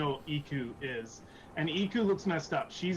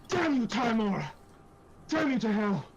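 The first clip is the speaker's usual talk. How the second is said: high, loud cry of acted fury; frustrated cursing; 8 semitones higher, 5 dB louder than usual. Iku kicks and damns Timora, loudly frustrated. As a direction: hurl the curse upward in frustration. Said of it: "she just seems absolutely frustrated".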